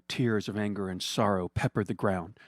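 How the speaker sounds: ordinary delivery, nothing marked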